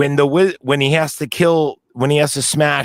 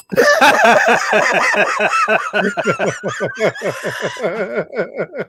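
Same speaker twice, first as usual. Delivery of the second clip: long, high-pitched real laughing fit; hearty laugh; 11 semitones higher than usual. Real, not acted. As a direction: laugh helplessly at the joke. Laughing at the joke.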